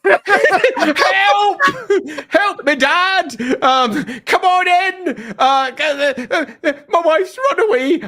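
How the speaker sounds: hammed-up high, slow wail for help; mock-crying husband calling for Dad; Samael. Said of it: "runs into a big marital problem and goes".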